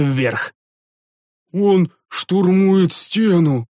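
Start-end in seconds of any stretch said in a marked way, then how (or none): 1.5-3.7 slow, high moan of dismay; a monk moans in dismay, slow and higher. Acted. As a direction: groan it out in despair. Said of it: "простонал один из монахов".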